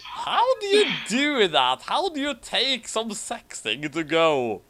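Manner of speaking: can't believe what you just read; incredulous. Real, not acted. Incredulous reaction to fic.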